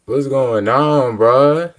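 slow, loud drawn-out hype greeting. Real, not acted. Reactor hyping up the video, loud drawn-out greeting. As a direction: call it out big to the viewers.

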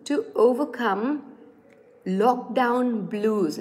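each word stretched very slowly; slow enough for learners to copy; very slow model. Shown as a demonstration. Teacher slowly modelling slogan's end. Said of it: "Repeat after me".

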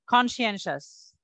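কনসিয়েনশাস said slowly, syllable by syllable; teacher models কনসিয়েনশাস slowly, kon-si-en-shas; pronunciation demo. Shown as a demonstration. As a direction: spell out কনসিয়েনশাস carefully for learners. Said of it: "কয়েকটা উচ্চারণ শিখাই দিই".

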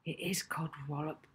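soft muttered aside; quiet aside to a friend; whispered. Acted. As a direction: say it softly so Edna can't hear. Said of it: "Molly whispered to Rocky".

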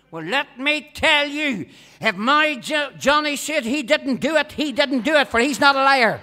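mother sticking up for Johnny, loud; indignant mother. Retold or imitated. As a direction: stand up for your son, loud and firm. Mother defends son indignantly.

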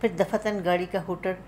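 ordinary delivery

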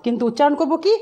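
ordinary talk

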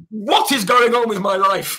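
loud, high, drawn-out exclamation with laughter; overwhelmed disbelief. Retold or imitated. Fan recalls a missed call from Danny Sullivan, overwhelmed disbelief. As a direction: cry it out slowly, laughing at your own luck.

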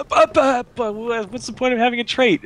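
very high squeaky whine; whiny mock beetle. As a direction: squeak it out like a confused bug. Frustrated player mocking dumb beetles.

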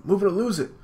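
high, loud bossy sister. Acted. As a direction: bark it out high. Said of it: "it's like".

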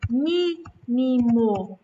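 "Mínimo" said very slowly with MI pushed hard; slow teaching stress on MÍ of "Mínimo". Shown as a demonstration. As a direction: lean on the first syllable of "Mínimo". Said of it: "Mi, a gente fala com mais força".

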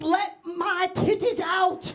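hammed-up cry for release; retold scream. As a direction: plead slowly as if trapped. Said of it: "like, I'm screaming".

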